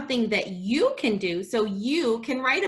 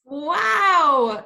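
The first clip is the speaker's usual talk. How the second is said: amazed wow; 4 semitones higher, 7 dB louder than usual. Real, not acted. Teacher reacts to story.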